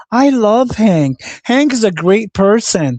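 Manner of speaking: high, slow, drawn-out mock fan voice; mocking gushing imitation. Mocking Venus's praise for Hank, high and slow. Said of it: "like, Venus says".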